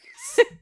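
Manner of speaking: sudden airy laugh; airy burst of laughter at the joke; breathy laugh burst. Real, not acted.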